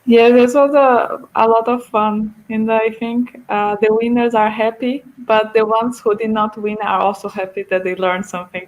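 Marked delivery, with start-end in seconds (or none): none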